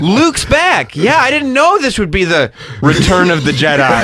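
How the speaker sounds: high, put-on movie character voice; mock film dialogue. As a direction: hammily work the title into the line. Parody of Star Wars title drop.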